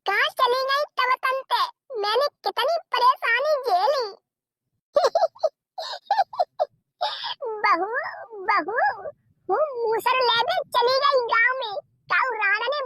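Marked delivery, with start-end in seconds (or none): 5.8-6.6 a shrill, high granny cackle; happy old woman's high laugh; high cackling laugh. Acted. Grandmother delighted at grandson.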